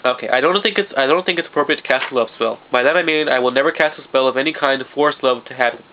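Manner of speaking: ordinary talk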